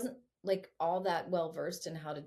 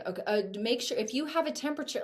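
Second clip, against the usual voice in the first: mock automatic email; 6 semitones higher, 5 dB louder, faster than usual.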